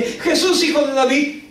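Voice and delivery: high, insistent call; imitated shouting. Woman keeps calling Jesus as he comes closer, imitated shouting. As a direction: keep calling him, pitched high. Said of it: "ella sigue gritando".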